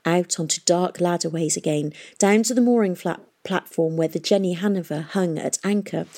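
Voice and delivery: plain reading voice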